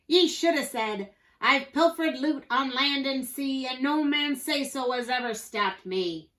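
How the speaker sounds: pirate shout. A narrator's reading. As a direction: shout it in salty pirate talk. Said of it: "Pete shouted".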